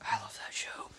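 real soft whispered aside. Real, not acted. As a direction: breathe it under your breath to yourself. Aside whispered under the chatter.

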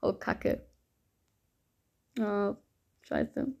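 muttered curse. Real, not acted. Annoyed that he laughed.

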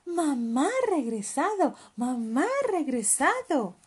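high, loud singsong news; joyful chant of good news; bouncy, loud and high; excited announcement. Acted. Animals announce mother's return.

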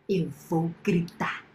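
slow, low, quiet warning; low threat. A narrator's reading. Girl warning the neighbour she will scream, low threat. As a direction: drop down low and measured.

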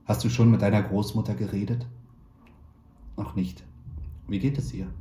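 ordinary delivery

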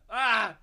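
loud sudden sneeze; a real sneeze bursting out; sneezing. Real, not acted. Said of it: "I'm gonna sneeze".

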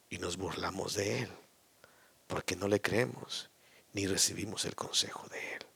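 whispered rebuke to the congregation; whispering. Acted. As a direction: drop to a hushed whisper. Preacher drops to a whisper.

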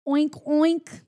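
a mock oinking, acting badly like a pig; bad pig imitation. Herbie pretending to be a pig to lure the snow monster, hammed-up oinks. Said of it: "Herbie has to act like a pig".

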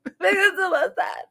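high, squeaky tearful wail through laughter; mock crying. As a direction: blubber it in hammed-up sobs. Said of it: "I could watch a commercial and cry".

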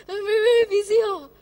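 fast, high, laughing Singlish whine. Retold or imitated. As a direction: squeal the excuse in Singlish, laughing. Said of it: "Example lah".

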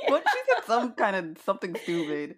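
loud, high, laughing words; helpless amused outburst. Real, not acted. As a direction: blurt it out while cracking up. Laughing over therapist's coy question.

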